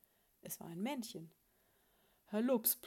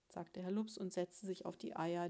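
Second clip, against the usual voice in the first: ordinary delivery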